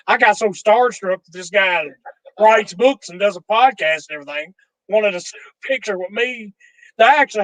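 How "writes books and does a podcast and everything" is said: normal talking voice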